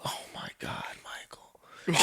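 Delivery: speechless, voiceless whisper; صدمة بلا كلام. Retold or imitated. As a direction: imitate stunned disbelief in a whisper.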